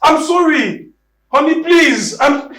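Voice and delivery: begging in a high cracking voice; high desperate plea; desperate pleading. Acted.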